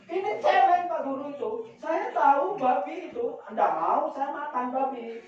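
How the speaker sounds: high-pitched mock protest; acted-out heated correction, starting high; indignant villager's retort.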